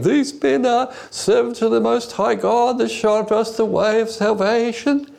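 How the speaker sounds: high, trance-like chant imitating a possessed slave girl; high-pitched voice. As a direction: mimic a half-hysterical girl in a high, sustained pitch. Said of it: "this high-pitched voice saying".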